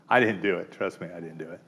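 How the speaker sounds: normal talking voice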